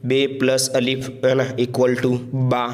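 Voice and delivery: slowly laid out step by step; walk through it slowly; slow classroom explaining; slow explanation. Shown as a demonstration. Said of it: "आप यूँ भी इसको पढ़ सकते हैं".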